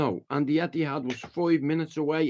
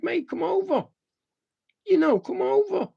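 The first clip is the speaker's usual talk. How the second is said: raised mock-yelled dare, much higher; daring him; 3 dB louder than usual. Retelling a standoff with a lad outside the Etihad, raised taunting dare. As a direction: egg him on to come closer. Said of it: "I was like".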